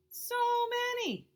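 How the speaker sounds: high, slow, drawn-out wonder; amazed. Acted. Child gasping at blueberries, high and slow amazement. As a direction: marvel at the pile of berries.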